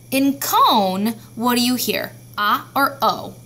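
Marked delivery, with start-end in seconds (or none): none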